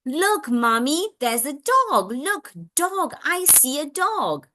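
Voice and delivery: put-on slow, high child-directed modelling voice; parallel talk. Parent modelling words for child who spotted dog, slow and animated. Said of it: "or you can parallel talk".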